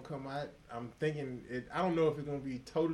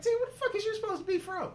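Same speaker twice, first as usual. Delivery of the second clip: mock outraged question; 4 dB louder than usual. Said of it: "He's like".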